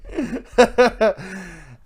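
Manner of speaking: high, loud burst of real laughter. Real, not acted. Podcaster laughing at own failed impression, loud and high. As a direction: crack up in a high cackling laugh.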